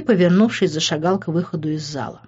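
ordinary delivery, nothing marked

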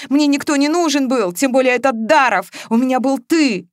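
anguished insistence; tearful protest. A narrator's reading. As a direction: plead through the tears. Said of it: "уже откровенно всхлипывала Маша".

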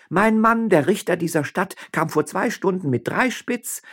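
high, loud commanding address; rally the witnesses against him; imperious scorn. Acted. Wife denouncing her husband to the mayor, imperious.

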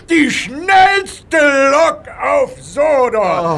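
slow, loud, high cry of pride; proud outcry. Acted. The boss proudly names Gordon the fastest engine on Sodor, loud slow outcry. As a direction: proclaim it, drawn out and ringing.

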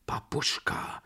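hushed, faltering, taken aback; stammering. A narrator's reading. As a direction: stammer her name in dismay. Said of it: "stammelte ich". (fassungslos stammelnd)

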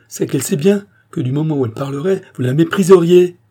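loud, high, building to a peak; impassioned accusation. A narrator's reading. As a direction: drive the accusation up to a cry. Queen's jealous outburst, escalating.

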